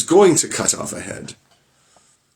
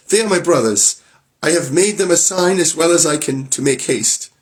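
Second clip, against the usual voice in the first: high, excited voice of a rescued wife; wife telling her sister the brothers have come; relieved wife; 8 semitones higher than usual. Acted. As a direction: rush it out with relief.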